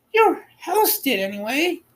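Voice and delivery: put-on high, lilting old woman's voice; little old woman. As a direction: voice her as a tiny kindly old woman.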